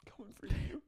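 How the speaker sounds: high, soft, breaking voice; mock choked-up reply. Husband answering his friend's praise, choked up and high. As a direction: squeak it out, overcome. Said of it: "And Danny goes".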